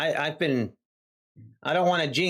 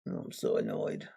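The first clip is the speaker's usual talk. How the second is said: breathy whisper, fed up; annoyed whisper under the breath; whispered annoyance; 9 dB quieter than usual. Real, not acted. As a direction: whisper it to yourself in frustration. Streamer frustrated at market.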